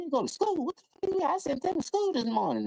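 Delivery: high shocked mother's voice; outraged mama. Retold or imitated. Mother learning her son skipped school, high and shocked. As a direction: jump way up as a mom who just found out. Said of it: "well, you know".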